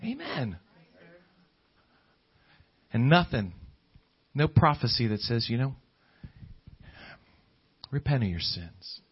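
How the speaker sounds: ordinary talk, nothing marked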